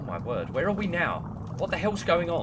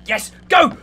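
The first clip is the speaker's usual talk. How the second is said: excited cheer; 9 semitones higher, 10 dB louder than usual. Real, not acted. Escaping the neighbour.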